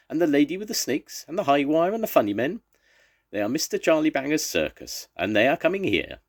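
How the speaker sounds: high, loud and thrilled; excited Banny. Acted. Banny hears his elephants coming, high and overjoyed. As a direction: call out each circus act with rising joy.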